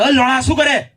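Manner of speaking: a sudden loud, high crude yell, mock relief; mock shout. Buddy butts in on job worries.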